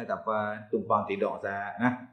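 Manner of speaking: hushed, humble asking for lodging; meek quiet request fading to a whisper; polite soft plea. Retold or imitated. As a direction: ask the spirit softly, almost whispering at the end. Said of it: "Saya kata kat dia".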